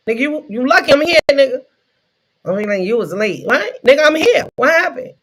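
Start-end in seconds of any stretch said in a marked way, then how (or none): 0.1-1.6 higher, louder cocky retort; indignant comeback. Acted. Worker talking back to the boss on Monday, indignant. 3.5-5.1 high, indignant challenge; defiant comeback. Acted. Late worker challenging the boss, mock-indignant. Acted answer to being called late.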